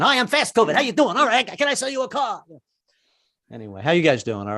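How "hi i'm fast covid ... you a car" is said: Brooklyn salesman pitch; Brooklyn guy. Retold or imitated. Comic does fast covid as a Brooklyn salesman, Brooklyn accent. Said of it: "the guy from Brooklyn".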